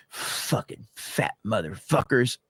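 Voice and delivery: slow, low imitation of a mad manager; quietly seething, half-laughing impression; mock fed-up anger. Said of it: "He was so mad".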